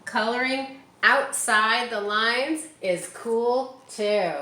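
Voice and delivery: loud, bright, slow storybook read; reading to children. Acted. Teacher reading her favourite page, loud and bright. Reads picture book aloud.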